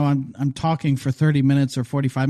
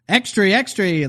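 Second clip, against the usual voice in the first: put-on higher-pitched old-time newsboy cry; 1920s fast talker; 6 semitones higher than usual. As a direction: imitate a twenties newsboy calling out. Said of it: "like, talks real fast".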